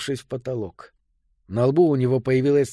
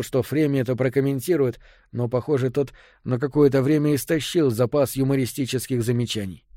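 usual speaking voice

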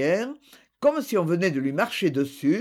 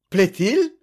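a startled, louder hiccup of a question; hiccuping surprise; 2 semitones higher, 6 dB louder than usual. A narrator's reading. Tourteret, stunned by the request, startled hiccup. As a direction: gulp it out in shock. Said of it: "hoqueta monsieur Tourteret".

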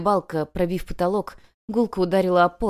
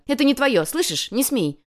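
raised, urgent warning; shouting; 8 semitones higher, 4 dB louder than usual. A narrator's reading. Girl forbids her rival to take photos, shouting. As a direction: order her off sharply. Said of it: "крикнула Ленка".

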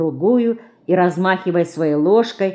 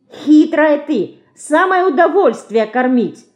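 protesting; 9 semitones higher, 5 dB louder than usual. A narrator's reading. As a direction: protest the unfair share to your sister. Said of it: "протестует мышка".

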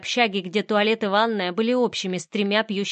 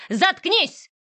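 much higher, louder bark; sharp angry cut-off; 11 semitones higher, 4 dB louder than usual. Acted. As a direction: slam the word down to silence her. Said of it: "резко перебила меня женщина". (резко оборвать)

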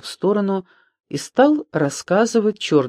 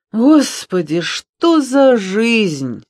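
high, loud, drawn-out lament; almost genuine anguish; 8 semitones higher, 5 dB louder, much slower than usual. A narrator's reading. Доня laments their life to friends, in half-acted anguish. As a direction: wail it slowly, nearly in earnest. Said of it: "С почти настоящей тоской".

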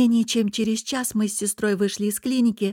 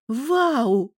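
higher, drawn-out exclamation of surprise; surprised wow; 4 semitones higher, 3 dB louder than usual. A narrator's reading. Sister hearing about the yoga classes, surprised. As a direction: let the wonder swoop up. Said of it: "удивилась она".

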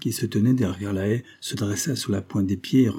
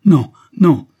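loud, higher protest; vehement refusal; 7 semitones higher, 13 dB louder than usual. A narrator's reading. Mother refuses to go with soldiers, vehemently loud. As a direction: refuse at full voice.

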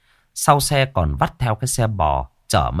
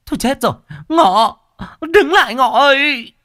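very high, loud panicked call; 5 dB louder than usual. A narrator's reading. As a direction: shout after the fleeing son. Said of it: "Hai ông bà bấy giờ mới chi hô". (bố mẹ gọi con hoảng hốt)

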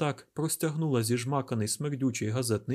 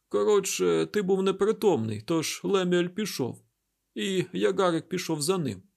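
higher-pitched, slightly louder character voice; injured woman recounting events; 7 semitones higher, 4 dB louder than usual. A narrator's reading. Derkhan tells Isaac about waking up, higher-pitched recounting. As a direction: voice a woman urgently telling what happened.

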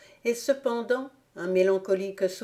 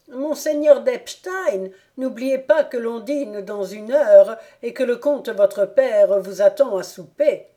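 calling after; 4 semitones higher, 7 dB louder than usual. Acted. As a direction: shout it after someone running off. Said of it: "lui cria".

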